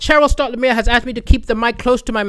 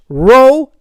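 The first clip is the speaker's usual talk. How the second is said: ordinary delivery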